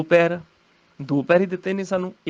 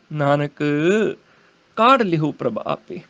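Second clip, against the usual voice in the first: Nanak lower, then a clear pause; pause after Nanak; 3 semitones lower than usual. Shown as a demonstration. Teacher showing where the verse halts, pause after Nanak. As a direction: stop on Nanak before going on. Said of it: "ਇੱਥੇ ਨਾਨਕ ਤੇ ਰੁਕਣਾ".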